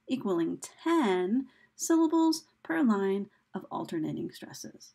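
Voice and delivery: usual speaking voice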